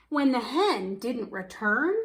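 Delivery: ordinary delivery, nothing marked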